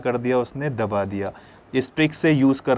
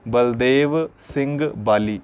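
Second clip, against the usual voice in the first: slow dictation; much slower than usual. Shown as a demonstration. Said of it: "क्या बोलेंगे?".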